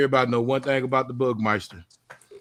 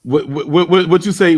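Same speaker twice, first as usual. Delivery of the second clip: flustered stammer, louder; stuttering; 3 semitones higher, 9 dB louder than usual. Real, not acted. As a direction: trip over the words, caught off guard. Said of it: "Now he's stuttering".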